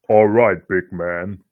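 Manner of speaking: low, booming bass for a big man; extremely deep bass voice. Retold or imitated. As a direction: drop the voice as deep as it goes. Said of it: "die tiefe Stimme rausholen".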